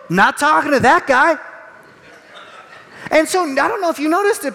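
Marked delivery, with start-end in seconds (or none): 0.1-1.4 wary refusal. Acted. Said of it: "You're gonna go".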